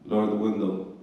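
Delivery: deep, low stern cop order; cop command. Retold or imitated. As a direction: bark it like a cop.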